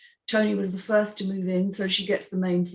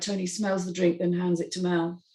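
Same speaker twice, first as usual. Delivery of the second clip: ordinary delivery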